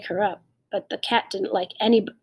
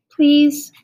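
pleading; 6 semitones higher, 9 dB louder than usual. A narrator's reading. Child pleads to hear the story.

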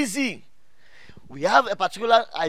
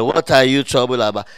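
louder, lower call to the congregation; louder prompting; 6 semitones lower, 6 dB louder than usual. Shown as a demonstration. Preacher who can't hear the congregation's answer, prompting louder. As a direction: push the question out louder. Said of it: "Oh, I can't hear".

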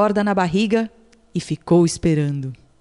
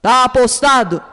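loud, higher shout; shouted bet; 4 semitones higher, 5 dB louder than usual. Acted. Alligator accepting the turtle's bet, loud shout. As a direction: bark out the bet. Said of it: "O jacaré gritou".

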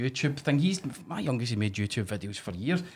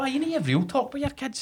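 mock earnest; 14 semitones higher than usual. Mock thought, very high.

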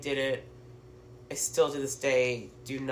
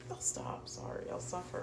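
whispered apology; 12 dB quieter than usual. Real, not acted. Apologizing after blowing nose.

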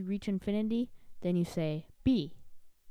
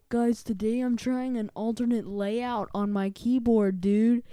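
higher, louder hyped YouTuber intro; keyboard YouTuber voice; 3 semitones higher, 6 dB louder than usual. Retold or imitated. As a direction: announce it like a tech channel host. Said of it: "They're like".

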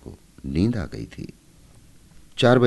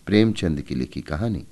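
ordinary delivery, nothing marked

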